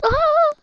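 high, loud yelp; short shriek of panic; alarmed scream. Real, not acted. Player fleeing virus carriers, alarmed scream. Startled by infected crowd.

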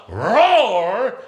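loud, long-drawn shout on the word; roared-out word. Real, not acted. Preacher urging the church to release the roar, loud drawn-out cry. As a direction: let the word swell into a roar.

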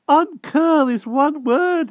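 very high, slow rustic country voice; a frightened old hedgehog begging humbly; timid hedgehog voice. A narrator's reading. Hedgehog begging the Wart for mercy, high timid rustic voice. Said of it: "answered the porcupine tremblingly".

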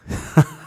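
sudden run of ha-ha laughs; real burst of laughter. Real, not acted. Host amused by a coincidence during the talk, bursting into laughter. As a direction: break up laughing.

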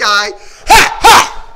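two explosive kiai shouts; karate kiai. Shown as a demonstration. Coach finishing the combo, forceful kiai yells. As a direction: yell with each punch. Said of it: "avec les kiai".

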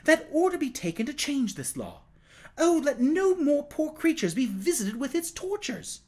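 high, earnest beseeching; impassioned plea. A narrator's reading. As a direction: implore on behalf of the suffering. Tom begs to end cruel law.

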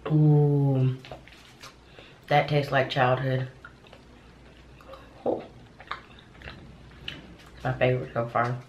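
speaking around chewy sweet; chewing while talking. Real, not acted. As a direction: mouth partly full of candy. Seen 2: Gummy eaten after -7; pursed, moving lips throughout labelled words.

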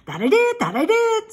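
high, quick goose voice repeating syllables; stammering goose. A narrator's reading. Goose coaxes Wilbur to come out, high and quick. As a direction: cluck the words out fast and high.

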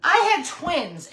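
ordinary talk

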